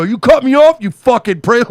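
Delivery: imitating an angry driver; mock road rage. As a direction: snap at someone who cut you off. Imagined road-rage yell at driver.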